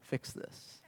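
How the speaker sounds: whispered mock order from mom; very soft, whispered aside imitating a mother; mother's hint. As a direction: slip it in under your breath, voiceless. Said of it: "is a mother's way of saying".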